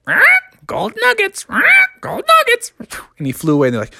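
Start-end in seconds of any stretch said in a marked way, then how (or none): 0.1-2.7 high squawky bird voice crying out; squawking bird. Acted. Bird in the sky squawking about the nugget, high and shrill. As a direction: screech it like a greedy bird. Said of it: "a bird up in the air was like".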